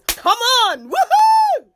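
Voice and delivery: high, loud whooping cheer; excited cheer. Real, not acted. Streamer whooping at her live audience.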